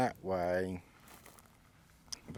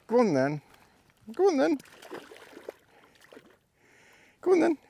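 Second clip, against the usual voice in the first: excited urging; 7 dB louder, much faster than usual. Real, not acted. Urging the dog on.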